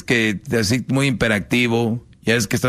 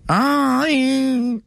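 very high, squealed delighted "ay"; mock swoon; 14 semitones higher than usual. Girlfriend squealing when her man finally says te amo. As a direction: squeal like she just melted.

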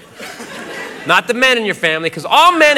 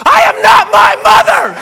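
slow, loud, very high-pitched screech; mock outraged scream; 14 semitones higher, 6 dB louder than usual. Woman answering a simple restaurant question, mock outraged scream. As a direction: scream each word in hammed-up outrage.

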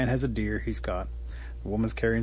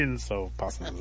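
usual speaking voice

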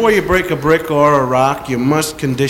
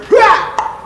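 sharp, much higher and louder ha; karate shout. Shown as a demonstration. Comic demoing the brick-breaking shout, loud sharp karate yell. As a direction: bark a karate ha. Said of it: "give off a loud shout".

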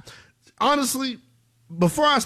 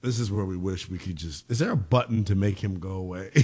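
normal talking voice

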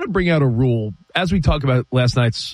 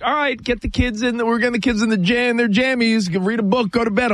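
higher-pitched imitation of a wife; lightly mimicked wife, pitched up; wife giving bedtime orders; 8 semitones higher than usual. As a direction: run through bedtime instructions as mom. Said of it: "my wife was like".

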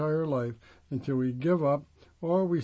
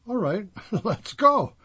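higher, louder retold "fine then", with a laugh; amused giving in; 6 semitones higher, 5 dB louder than usual. Gives in to governor, laughing.

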